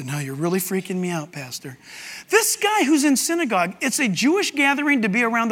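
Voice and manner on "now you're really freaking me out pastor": low, soft muttered complaint; uneasy churchgoer. Acted. Churchgoer complaining to the pastor, in a low murmur. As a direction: mutter it as a spooked listener, trailing off. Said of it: "You say".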